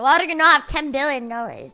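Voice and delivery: normal talking voice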